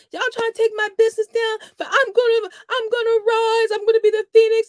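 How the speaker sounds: high, loud, hammed-up sobbing plea; mock dramatic wail. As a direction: wail it like a tragic heroine, for laughs. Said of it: "I was being funny".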